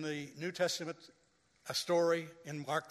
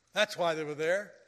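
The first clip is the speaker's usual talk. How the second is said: ordinary delivery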